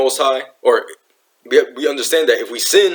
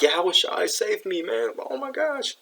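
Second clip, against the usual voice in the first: a sinking man's frightened cry for help; panicked plea; 10 semitones higher, 7 dB quieter than usual. Retold or imitated. Peter sinking in the sea begging to be saved, panicked. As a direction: pitch climbing higher and higher, getting softer. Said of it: "He had fear".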